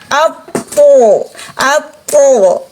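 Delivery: "apo" as a heavy emphatic t with an o-coloured vowel; thick THO. Shown as a demonstration. Teacher asking what makes this letter sound so, thick "apo". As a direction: make the second syllable of "apo" thick and rounded. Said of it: "sampai dia bunyi po".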